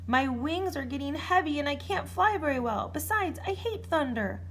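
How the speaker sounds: a worn-out dragon complaining; weary dragon. A narrator's reading.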